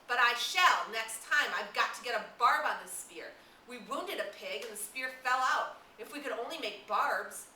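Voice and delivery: put-on loud, high, indignant boy arguing; heated insistence. Jack to Ralph, defending his hunting, loud and heated.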